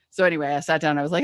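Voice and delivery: usual speaking voice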